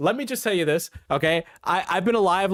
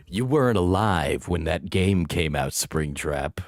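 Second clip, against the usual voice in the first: mean taunting voice; 8 semitones lower than usual. Pretend. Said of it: "now I'm just being mean".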